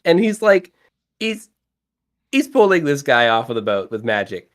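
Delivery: ordinary delivery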